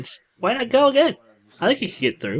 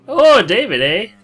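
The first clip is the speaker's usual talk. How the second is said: put-on British accent; 4 semitones higher, 5 dB louder than usual. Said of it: "was that some kind of British accent?".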